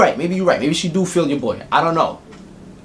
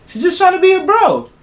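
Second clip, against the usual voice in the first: laughing; 4 dB louder than usual. Real, not acted. Laughing punchline.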